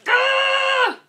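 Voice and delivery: high, loud "ugh" of exasperation; long, high cry of exasperation; frustrated scream. Real, not acted. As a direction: let out a frustrated shriek. Venting her anger.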